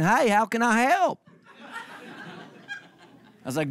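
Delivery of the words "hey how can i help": lightly voiced elderly repairman, slow and higher; cheery old Bob. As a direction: play old Bob, friendly and unhurried. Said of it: "Bob comes in".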